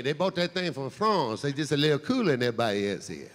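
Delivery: put-on lightly French-tinged Louisiana accent. Comic showing the crowd Louisiana's French thing, low accent. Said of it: "they got the French thing".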